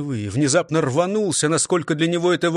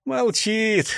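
mocking laugh; 8 semitones higher than usual. A narrator's reading. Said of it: "засмеялся Лихарь".

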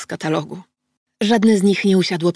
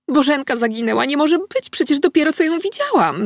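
high, alarmed disbelief; zaskoczony okrzyk; 8 semitones higher than usual. Acted. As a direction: gasp it out, shocked she's gone. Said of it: "zawołała kobieta". (zdumiony, wysoki okrzyk sąsiadki)

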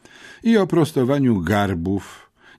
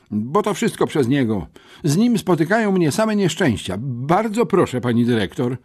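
high-pitched schoolboy begging; anxious pleading; 8 semitones higher than usual. A narrator's reading. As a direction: beg the headmistress, voice raised high. Pupil begs to avoid his classmate. (błagalny, wysoki głos ucznia)

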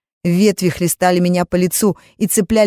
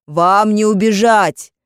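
slow, higher, louder threat; menacing shout; 4 semitones higher, 5 dB louder, slower than usual. Acted. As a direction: drag out the threat. Mages threatening in pursuit.

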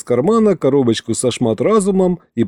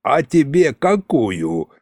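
slow, sly needling; snide drawl; 2 semitones higher, slower than usual. A narrator's reading. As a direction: draw it out snidely. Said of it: "ехидно протянул Шматразум". (ехидно, протяжно)

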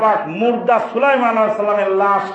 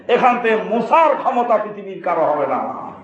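usual speaking voice

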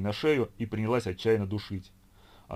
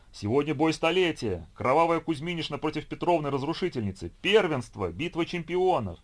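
excited fan; 6 semitones higher, 3 dB louder than usual. A narrator's reading. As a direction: announce the big fight with glee. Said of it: "радостно отозвался тот".

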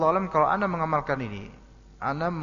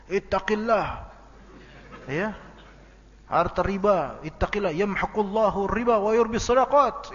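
higher, louder little preacher reciting Arabic; mock child preacher, hammed-up sermon; child preaching; 7 semitones higher, 5 dB louder than usual. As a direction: play a kid lecturing an adult like an ustaz. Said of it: "kalau anaknya bisa kayak gitu ceramah".

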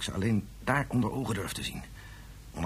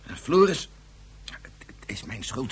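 guilt-stricken; slower than usual. Acted. Father breaking with guilt.

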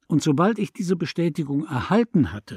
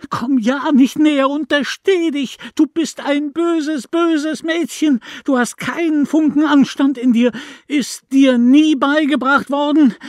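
high, loud, shaking rage; a mother losing control, shrieking; loud wild trembling voice; 13 semitones higher, 8 dB louder than usual. A narrator's reading. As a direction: scream at the girl with trembling fury. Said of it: "eine laute, wilde, zitternde Stimme".

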